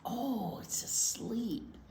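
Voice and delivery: slow, hushed whisper. Acted. Librarian whispering to children not to wake Bear. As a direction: whisper so Bear stays asleep.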